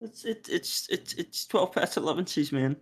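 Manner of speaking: high, fast begging voice; high pleading character voice. Acted. Starving characters beg for food.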